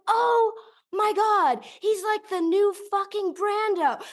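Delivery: high-pitched re-enacted gushing; awestruck raving. Moviegoer ignoring the shushing around him, high awed outburst. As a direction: rave high, unable to stop. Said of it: "I went on this monologue".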